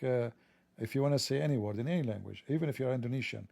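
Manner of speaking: normal talking voice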